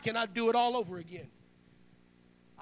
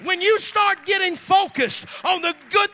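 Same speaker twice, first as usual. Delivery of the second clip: rising, louder sermon cadence, driving each line home; full-force preaching; 6 semitones higher, 8 dB louder than usual. Acted. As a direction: preach it out to the back row.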